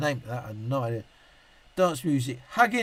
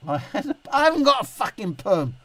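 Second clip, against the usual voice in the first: irritated denial; 6 semitones higher, 4 dB louder than usual. Real, not acted.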